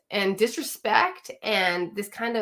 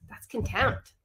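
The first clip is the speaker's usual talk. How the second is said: quieter repeat; 5 dB quieter, slower than usual. Shown as a demonstration. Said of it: "coach repeating it softly".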